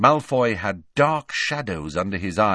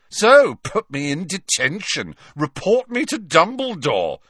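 high, loud mocking jeer; 8 semitones higher, 6 dB louder than usual. A narrator's reading. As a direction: taunt him, sneering. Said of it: "jeered Malfoy".

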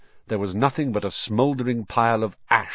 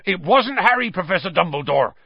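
high, pressing plea to the headmaster; agitated, pitched-up protest; urgent Hagrid; 9 semitones higher, 4 dB louder than usual. A narrator's reading. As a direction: burst out urgently in defence of a friend. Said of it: "said Hagrid urgently".

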